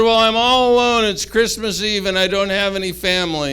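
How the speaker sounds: a hint of a forlorn little bunny calling out; lonely bunny. Lonely bunny answers the wolf.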